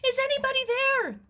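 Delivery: high, loud, fast panicked shriek; frantic neighbour girl shrieking for help, high and loud; frantic cry for help. Acted. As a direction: scream for help, close to tears. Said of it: "The voice was frantic".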